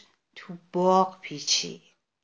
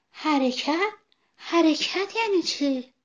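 much higher, bewildered question; puzzled sunflower; 9 semitones higher, slower than usual. Acted. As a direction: ask like a confused child.

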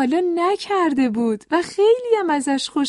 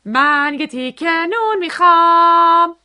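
slow, higher, drawn-out bellow; loud shout; 4 semitones higher, 8 dB louder than usual. A narrator's reading. Noisy man back at the bakery ordering bread, shouting loudly. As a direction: holler it out, holding the last word. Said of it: "با صدای بلند گفت".

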